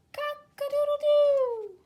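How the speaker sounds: high, loud, slow cock-a-doodle-doo; rooster crow. Shown as a demonstration. Teacher demonstrating the rooster call for the child to copy, loud high crow. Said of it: "Can you say that?".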